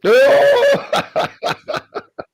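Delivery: sudden high, loud real laugh; burst of laughter. Real, not acted. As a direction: crack up at the challenge. Caller bursts out at challenge.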